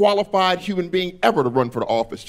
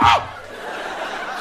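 high breathy startled shriek; mock scream back. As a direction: scream in reply, half laughing. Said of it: "I was like".

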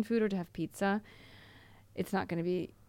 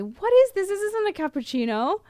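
high, loud mock-indignant question; mock disbelief; 12 semitones higher, 6 dB louder than usual. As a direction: play an appalled customer, pitched up and loud. Acts out customer's disbelief at coffee.

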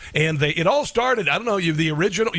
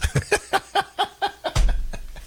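high breathy burst of laughter; big laugh. Real, not acted. Host cracking up at his own haka joke, laughing.

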